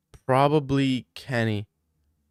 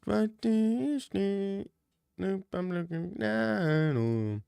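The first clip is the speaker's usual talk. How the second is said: high slow put-on voice; 8 semitones higher, 5 dB quieter, much slower than usual. Strange high slow voice bit.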